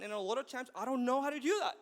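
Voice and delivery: normal talking voice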